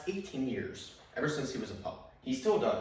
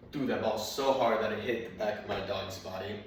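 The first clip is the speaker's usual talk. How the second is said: ordinary delivery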